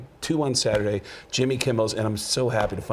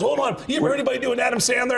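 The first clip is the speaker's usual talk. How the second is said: Belzer egging on; 12 semitones higher, faster than usual. Retold or imitated. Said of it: "Belzer goes".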